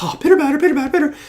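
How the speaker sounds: high silly babbling; mock babble. Mock babble answering the anchor.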